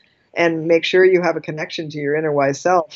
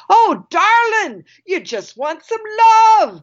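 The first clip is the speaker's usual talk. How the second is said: high, slow, drawn-out Australian man; Australian accent; 13 semitones higher, 5 dB louder, slower than usual. Retold or imitated. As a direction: gush it out in a big Australian accent. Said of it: "he had giant Australian accent".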